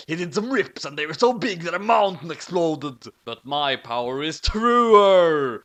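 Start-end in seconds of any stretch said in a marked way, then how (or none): 3.2-5.6 loud, slow bellow; slow booming battle cry. A narrator's reading. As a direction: boom it out like a warrior. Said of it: "he bellowed".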